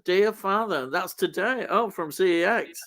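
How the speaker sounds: ordinary talk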